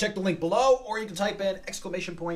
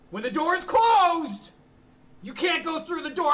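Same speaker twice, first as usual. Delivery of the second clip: normal talking voice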